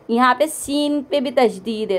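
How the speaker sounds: ordinary talk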